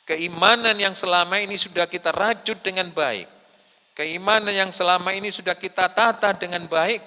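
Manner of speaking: high, loud sermon voice in parallel phrases; impassioned preaching. Real, not acted. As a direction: ring out each phrase to the congregation. Preaches at full force. (berkhotbah lantang dengan nada tinggi)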